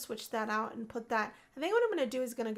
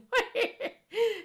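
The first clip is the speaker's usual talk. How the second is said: laugh; 11 semitones higher, 7 dB louder than usual. Real, not acted. Laughing along with sim.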